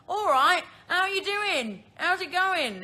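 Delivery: loud, high, hammed-up British greeting; bad British accent. Shown as a demonstration. Sending up her own accent, bad British accent. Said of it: "like, a bad British accent".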